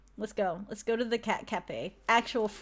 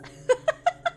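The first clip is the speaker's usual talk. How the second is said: high cackle; 6 dB louder than usual. Acted. Villain bursts out laughing.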